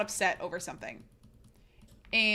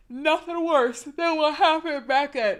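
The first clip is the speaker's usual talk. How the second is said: indignant protest; 6 semitones higher, 8 dB louder, slower than usual. Acted. Defending herself to chat.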